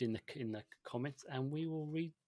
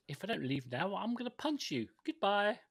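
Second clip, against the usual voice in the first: 1 mock threat; 8 semitones higher, 5 dB louder than usual. Joking threat, leaving.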